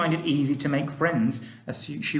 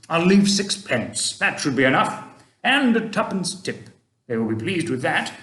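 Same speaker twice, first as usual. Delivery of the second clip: put-on a kindly old gentleman, louder and gently advising; Mister Stink; 2 semitones higher, 3 dB louder than usual. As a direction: speak like an old tramp giving fatherly advice. Mister Stink paying the bill.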